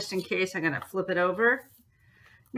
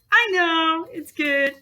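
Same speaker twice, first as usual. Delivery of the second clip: much higher, mock-proud answer to herself; mock smug; 8 semitones higher, 4 dB louder than usual. Teacher answering her own praise of the crease, mock smug. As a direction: preen like you're praising yourself.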